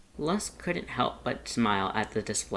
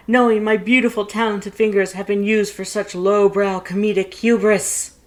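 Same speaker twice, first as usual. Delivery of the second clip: over-exaggerated lament; 12 semitones higher, 12 dB louder than usual. A narrator's reading. Paps laments his glove used for a prank, overdone. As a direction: mourn the ruined hand like a stage tragedy. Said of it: "his over-exaggerated lamentations".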